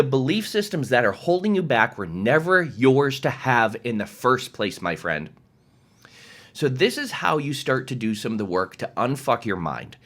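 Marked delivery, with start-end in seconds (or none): none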